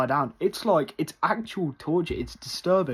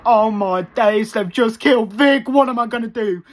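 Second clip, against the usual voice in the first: reliving the scene, high frantic alarm; panicked; 9 semitones higher, 9 dB louder than usual. Retold or imitated. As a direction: shriek it like the world just ended. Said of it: "I was like".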